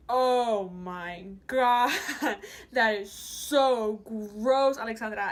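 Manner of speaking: slow, loud, drawn-out disgust; hammed-up appalled mother; mock horrified mom. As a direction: stretch each word in scandalized horror. Mimics mom's horrified reaction.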